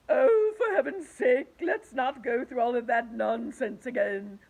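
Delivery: loud, slow, high exasperation; sick of the fuss; exasperated. Acted. The earthworm snaps at the centipede, loud and exasperated.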